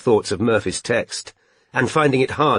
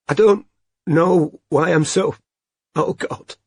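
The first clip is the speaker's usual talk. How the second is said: high, slow, tearful breakdown; crying; 7 semitones higher, slower than usual. A narrator's reading. Robin to Strike, unable to stop crying, high and slow. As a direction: crumble into tears. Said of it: "Unable to stop herself crying".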